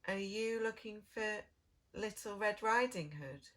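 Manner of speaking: ordinary delivery, nothing marked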